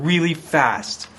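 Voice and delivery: ordinary delivery, nothing marked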